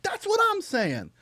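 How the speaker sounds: very high, drawn-out exclamation; stretched, high-pitched retold outburst; exasperated agreement. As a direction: squeal it slowly in hammed-up vindication. Retelling emphatic agreement with witness.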